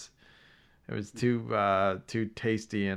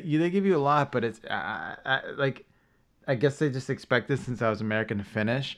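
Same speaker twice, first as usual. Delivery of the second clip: ordinary talk, nothing marked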